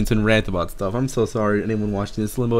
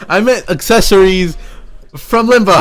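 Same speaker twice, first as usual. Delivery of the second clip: flustered protest; 10 semitones higher, 5 dB louder than usual. Real, not acted. Protesting Kenny's comment.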